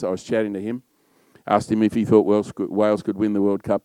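ordinary delivery

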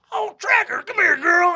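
high, loud, gushing cry; effusive greeting. Acted. Jawbone greeting Tracker before a big hug, effusive.

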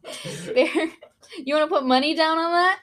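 laughing through a quick teasing question. Real, not acted. Laughing as she dares her co-host to bet. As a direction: giggle it out, egging her on.

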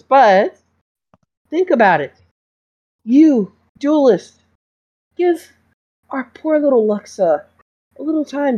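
a smooth talking school doctor feigning innocence; smarmy doctor. Acted. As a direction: play the doctor as falsely sweet and wounded. GM holds doctor voice.

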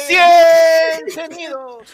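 slow, drawn-out high moan; mock moaning. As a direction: stretch it out like a fake moan. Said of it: "Qué imbécil". (gemido exagerado y lento)

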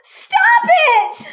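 high, loud scream at the crowd; a child's full-throated scream to stop the mob. Acted. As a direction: shriek it with everything in your lungs. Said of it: "let out a scream".

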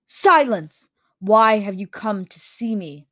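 loud, imperious cut-off; commanding creature. A narrator's reading. Creature cutting off its visitors, loud command. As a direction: silence them loudly, then demand an answer.

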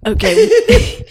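high laughing okay; trying to stop laughing; real laughter. Real, not acted. Recovering from laughing fit.

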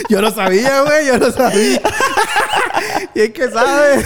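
high, laughing disbelief; giggling surprise at the radio codes. Real, not acted. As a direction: laugh through the words, amazed.

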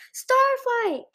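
high, loud cry of delight; joyful cry. A narrator's reading. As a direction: call out a friend's name in happy surprise. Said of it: "interrupted herself with a joyful cry".

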